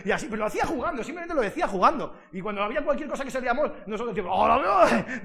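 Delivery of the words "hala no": loud, high mock exclamation; playful imitation. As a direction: cry it out in fun, mimicking the TV man. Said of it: "Decíamos así, pero lo decíamos en plan jugando". (exclamación fuerte y aguda, jugando a imitar)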